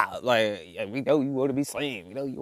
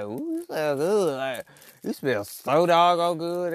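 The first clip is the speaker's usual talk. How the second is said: mock flatterer; 5 semitones higher, slower than usual. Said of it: "they be like".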